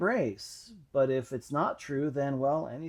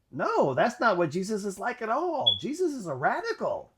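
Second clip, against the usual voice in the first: higher and louder, insistent correction; emphatic girl correcting; 7 semitones higher, 5 dB louder than usual. Retold or imitated. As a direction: set him straight with conviction. Girl corrects him firmly.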